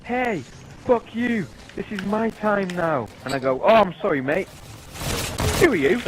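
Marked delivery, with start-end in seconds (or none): 0.0-3.1 high, indignant wrestler impression; re-enacted indignant shout; mock angry outburst. Acting out angry wrestler's interruption. 3.6-6.0 high, loud sarcastic retort; cheeky re-enacted heckle back; mock apology. Acting out sarcastic retort to wrestler.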